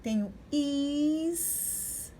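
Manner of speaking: "is" drawn out very slowly with a long hiss; teacher sounding out "is" as in isqueiro; syllable demo. Shown as a demonstration. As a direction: stretch "is" slowly. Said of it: "coloco o S depois".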